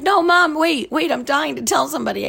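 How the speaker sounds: high, urgent no mom wait. Retold or imitated. Daughter stopping mom from hanging up, urgent. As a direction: blurt it out before she hangs up.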